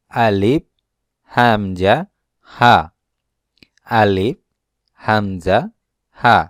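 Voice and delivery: আলিফ, হামজা, হা said from the throat, each drawn out; throat letter demo. Shown as a demonstration. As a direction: demonstrate the throat letters one by one. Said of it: "এই তিনটা অক্ষর কণ্ঠনালীর প্রথম ভাগ হতে উচ্চারণ হয়".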